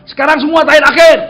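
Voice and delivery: loud, high order to a crowd; shouted command. Retold or imitated. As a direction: call the whole room to order. Calling a command to congregation.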